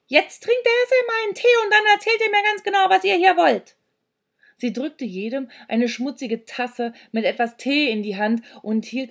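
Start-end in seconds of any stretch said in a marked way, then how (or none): 0.1-3.8 high, fast, loud screech; bossy high-pitched tirade; yelling old woman. A narrator's reading. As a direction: rattle off orders in a screeching crone voice. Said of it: "schrie die seltsame kleine Frau uns an".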